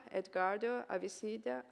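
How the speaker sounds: ordinary talk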